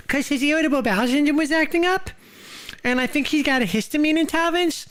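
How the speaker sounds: high, silly put-on voice telling a story about his friend; goofy high character voice. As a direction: spin the yarn in a squeaky dude voice. Character voice again.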